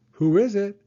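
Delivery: high, loud call at the door. Acted. Child calling at the door.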